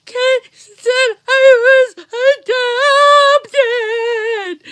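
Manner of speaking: very loud, high, stretched-out wail; a sobbing little girl howling the news; wailing child. Retold or imitated. Little girl wails to her dad that she was told she's adopted.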